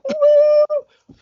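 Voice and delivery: very high hammed-up whoop; rock whoop. Imagined rock star firing up the crowd, high whoop. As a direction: cheer like a guitar hero on stage. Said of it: "get the guitar out and be like".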